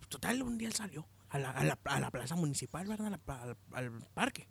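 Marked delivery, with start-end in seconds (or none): none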